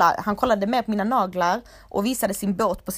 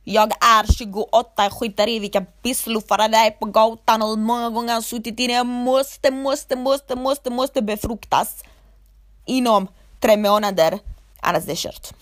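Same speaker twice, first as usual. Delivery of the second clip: louder, frantic mock desperation; mock desperate woman; 6 dB louder than usual. Mocking women desperate to marry, loud and frantic. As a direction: ham up a panicking bride-to-be. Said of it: "de typ känner att".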